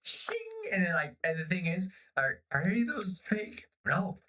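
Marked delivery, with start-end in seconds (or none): 0.0-0.4 shing gleam sound. Retold or imitated. Imitated teeth gleam.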